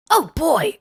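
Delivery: high, excited cartoon-boy "Oh boy!"; Ash Ketchum voice. Showing the only American accent, high Ash Ketchum voice. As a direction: squeal it like an eager cartoon kid. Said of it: "an Ash Ketchum voice, um, and it goes like".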